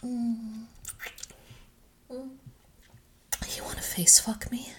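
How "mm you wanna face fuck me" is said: ordinary talk, nothing marked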